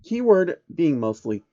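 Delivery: usual speaking voice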